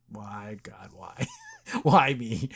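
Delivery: low, hushed mock despair rising at the end; mock anguish. Acts character's anguished plea, brother near.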